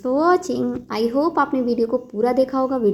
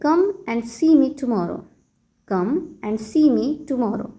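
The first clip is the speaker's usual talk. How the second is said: very slow, teacherly model phrase; slow model sentence; much slower than usual. Shown as a demonstration. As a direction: spell it out slowly for learners. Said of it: "तो आप कहिए". (बहुत धीमे, सिखाने वाले अंदाज़ में)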